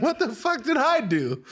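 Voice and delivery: high, drawn-out mock protest; baffled girlfriend. Girlfriend looks at him bewildered, high slow imitation. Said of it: "And Tani's just like".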